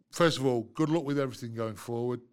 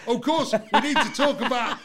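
laughing; 10 semitones higher, 6 dB louder than usual. Real, not acted. Laughing, excited.

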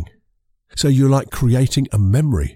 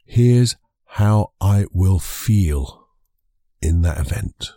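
slow dictation; 3 semitones lower, much slower than usual. Shown as a demonstration. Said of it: "a little bit of a structure".

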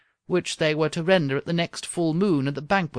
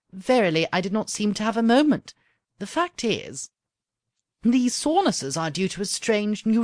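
a hint of an eager young princess, somewhat higher; lightly voiced princess; 4 semitones higher than usual. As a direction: let the princess gush about her new faith. Princess figure voice.

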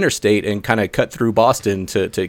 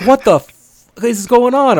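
high, louder burst of bewilderment; freaked-out disbelief; 12 semitones higher, 4 dB louder, slower than usual. Retold or imitated. Driver telling friends about a wheelchair in the street, freaked-out disbelief. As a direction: blurt it out high, aghast at the sight. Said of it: "freaking out".

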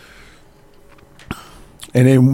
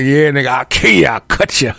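lightly voiced hard threat; mock tough rapper. Window Wash glaring by bloody windows, mock threatening. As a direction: put on a hard rapper's menace. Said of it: "He's sitting there looking like".

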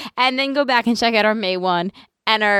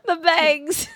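laughing; 10 semitones higher than usual. Real, not acted. Laughing echo.

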